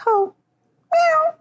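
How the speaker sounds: very high squeaky mock meow. Little sister hinting the cat is gone, squeaky high meow. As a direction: tease her with a tiny high meow. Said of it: "she was like".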